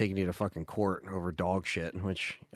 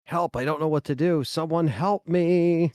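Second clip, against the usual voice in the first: high, whiny-poster cry for help, last words drawn out; mock someone begging for help, louder at the end; hammed-up helpless wail; mock helpless plea; 8 semitones higher, 5 dB louder, slower than usual. Mocking helpless posters asking Reddit.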